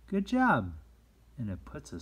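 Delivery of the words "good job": high, loud "Good job" falling at the end; shower the child with praise; cheerful praise. Shown as a demonstration. Teacher modelling praise for a friend's drawing, high and loud. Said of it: "So just say".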